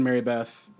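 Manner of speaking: ordinary talk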